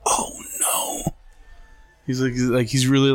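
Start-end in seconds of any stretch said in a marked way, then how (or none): 0.1-1.1 a tearful whispered oh no; imitating a choked-up whisper; humble whisper. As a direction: whisper it, overcome. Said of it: "He goes".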